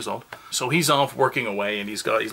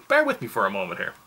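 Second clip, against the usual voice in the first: ordinary talk, nothing marked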